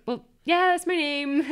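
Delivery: high, loud mock-indignant protest; indignant insistence. To the crew who only knew her as Elle, mock-indignant insistence.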